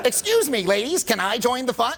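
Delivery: high-pitched hammed-up approach with laughter in it; mock polite intrusion. Coach replaying the bad opener to ladies, hammed-up politeness. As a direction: ham up a high, eager, polite request. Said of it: "if you said it like that".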